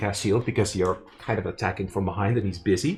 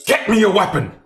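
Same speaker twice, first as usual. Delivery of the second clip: urgent shouted demand; panicked fisherman grabbing for help; fish man; 9 semitones higher, 6 dB louder than usual. Acted.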